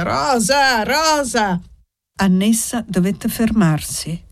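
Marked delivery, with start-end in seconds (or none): 0.0-1.6 put-on raised call of the old priest, a touch higher. A narrator's reading. The old priest calls out to Rosa, raised and insistent. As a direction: call the child's name across the square. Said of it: "cominciò a gridare".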